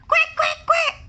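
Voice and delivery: high, loud duck quacks; excited quacking. Acted. Ducks quacking as the man reaches, high and loud.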